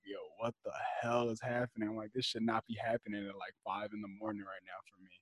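very soft, baffled muttering to oneself; stunned undertone. Retold or imitated. Watching a fight at five in the morning, quietly stunned. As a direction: drop the volume right down in disbelief.

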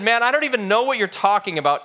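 high, fast, loud protest; agitated denial. A narrator's reading. Peter denying Jesus a third time, agitated protest. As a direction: blurt out the denial.

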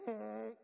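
loud honking goose call. Shown as a demonstration. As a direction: honk it out like a goose. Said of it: "That's my best goose impression right there".

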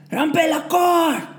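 slow, high drawn-out yell; shouted order. A narrator's reading. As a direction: stretch the command out loud. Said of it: "the next order came".

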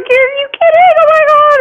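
high excited squeal, imitating her younger self; retold thrilled squeal. Actress reliving the casting call, high excited squeal. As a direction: shriek it up high like the news just landed. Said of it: "I was so excited".

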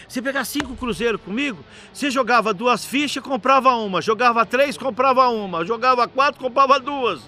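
high, emphatic counting-out; acted insistent lecture. Father explaining the deal to bar owner, insistent.

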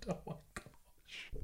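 barely voiced, slow aside to self; voiceless, drawn-out disbelief; amused whisper. Real, not acted. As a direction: let it out as a faint whisper. Breaks up at own childhood story.

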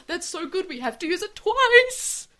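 high loud thrilled shout; excited. Pretend. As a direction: hype it up like a delighted writer. Mock excited writers.

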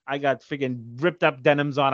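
usual speaking voice

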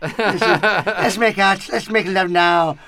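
loud, high hammed-up Irish accent; big, drawn-out cartoon Irish brogue; cartoon Irish leprechaun. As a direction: shout a mock come-on as an Irish leprechaun. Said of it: "make love to the leprechaun".

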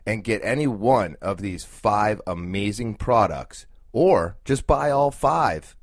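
upbeat sponsor read, spelling out the web address. Acted. Podcast host reading a sponsor ad to listeners, upbeat ad read. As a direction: sell the product like a radio spot.

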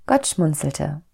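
ordinary delivery

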